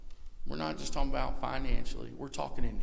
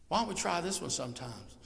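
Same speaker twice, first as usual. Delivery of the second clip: ordinary delivery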